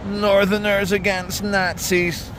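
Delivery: slow, high sneering sticker read; mocking read. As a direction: sneer each word out slowly. Reading an activist sticker mockingly.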